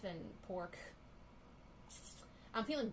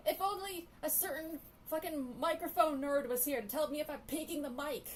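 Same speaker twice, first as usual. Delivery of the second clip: loud sharp voice; 6 semitones higher, 5 dB louder than usual. Real, not acted. Still yelling, teasing.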